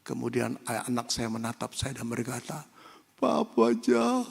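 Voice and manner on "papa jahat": put-on slow, high child's accusation; a wounded little boy blaming papa; hurt child. Injected boy tells his father he is mean, slow and high.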